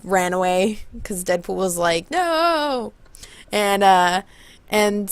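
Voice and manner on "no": high, drawn-out mock wail; mock refusal wail. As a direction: imitate a reluctant hero whining no. Deadpool refuses, runs away.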